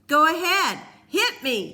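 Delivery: high, loud, furious daring; furious taunt. A narrator's reading. Peter goading Cole to hit him, furious shouting. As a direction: scream it as a dare to strike. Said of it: "he taunted".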